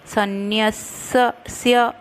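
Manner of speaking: सन्न्यस्य with half स joined to य, said slowly. Shown as a demonstration. Teacher drilling the conjunct in सन्न्यस्य for students. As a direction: sound सन्न्यस्य as san-nyas-ya, slow and exact. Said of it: "आधा स और य में".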